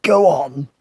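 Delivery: loud, husky lion urging. A narrator's reading. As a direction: push it out through a sore throat. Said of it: "said the Lion huskily".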